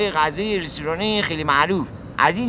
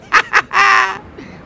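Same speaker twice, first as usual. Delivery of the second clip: loud, high burst of laughter; 11 semitones higher, 6 dB louder than usual. Acted. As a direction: cackle at your own joke. Passenger laughs at own joke.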